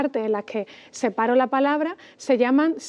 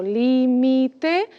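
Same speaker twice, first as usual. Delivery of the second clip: "límite" as lí, mi, te, slowly; slow "límite", syllables apart; split into syllables. Shown as a demonstration. Teacher separating the word to count, slow syllables.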